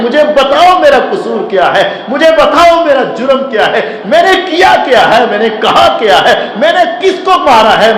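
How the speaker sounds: high, pleading cry of an innocent man; desperate pleading. Acted. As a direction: beg them to tell you your crime. Jurayj pleading while being beaten.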